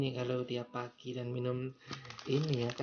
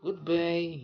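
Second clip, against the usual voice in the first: Italian accent; 4 semitones higher, 5 dB louder than usual. Shown as a demonstration. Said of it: "atau good, goodbye".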